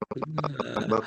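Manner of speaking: choppy robotic call audio; glitching robot. Real, not acted. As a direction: voice breaking up like a robot.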